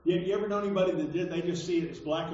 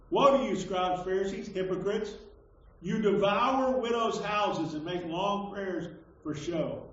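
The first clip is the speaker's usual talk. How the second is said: declaimed scripture reading; 3 semitones higher, 3 dB louder than usual. Acted. Preacher reads Jesus's woes to hypocrites, declaimed. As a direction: hit the opening woe loud, then read on firmly.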